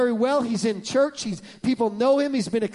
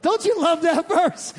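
normal talking voice

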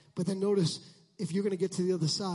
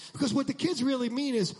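ordinary talk, nothing marked

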